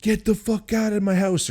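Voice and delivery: high retold yell with a strained edge; screamed. Homeowner yells at the supposed burglar, high strained yell. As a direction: bark the order down the hallway. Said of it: "screaming down the hall".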